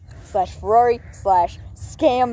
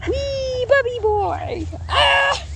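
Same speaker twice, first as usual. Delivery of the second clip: squeaky voice; 13 semitones higher, much slower than usual. Pretend. Voicing pets, high squeals.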